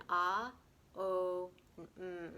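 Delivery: Ah, O and Mm drawn out one by one; three syllable Om. Shown as a demonstration. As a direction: sound each part of the Om in turn. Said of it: "do it in three syllables".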